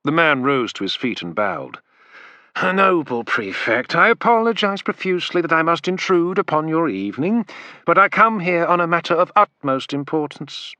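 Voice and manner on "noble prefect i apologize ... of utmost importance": high, oily fawning merchant voice; bow and scrape as the merchant. A narrator's reading. Merchant bowing to the prefect, fawning voice.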